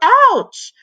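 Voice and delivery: high loud ouch; pained cry. A narrator's reading. Boy hit by the drumstick, crying out. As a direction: cry out in pain.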